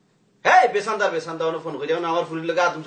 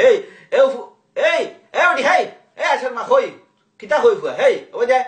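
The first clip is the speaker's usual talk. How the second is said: calling out; 4 semitones higher than usual. Real, not acted. Father calls out angrily to household.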